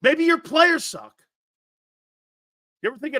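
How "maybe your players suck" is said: higher, slow, punched-out "Maybe your players suck"; emphatic jab. Real, not acted. Sports host throwing blame back at the fans, emphatic.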